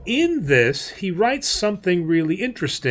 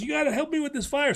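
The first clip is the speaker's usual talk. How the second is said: higher, quick, pleading retold voice; mock crying plea; 9 semitones higher, 5 dB quieter, much faster than usual. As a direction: imitate a desperate clown begging, lightly and fast. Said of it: "the clown starts crying and says".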